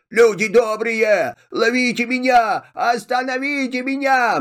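high, pleading cry to be stopped; frightened cry for help. Acted. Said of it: "кричит".

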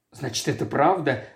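ordinary delivery, nothing marked

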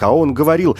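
plain reading voice

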